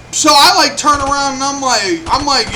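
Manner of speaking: normal talking voice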